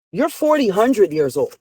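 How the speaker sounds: ordinary talk, nothing marked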